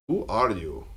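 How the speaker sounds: lightly voiced Japanese accent. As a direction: ask it with a hint of a Japanese accent. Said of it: "with a heavy Japanese accent go".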